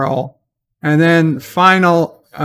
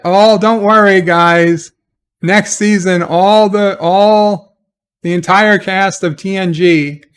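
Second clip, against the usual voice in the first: raised, loud fake-cheery announcement; hammed-up "relax, everyone" pitch; mock reassurance; 4 semitones higher, 4 dB louder than usual. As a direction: parody a placating promo to worried fans. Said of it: "they had to put out that".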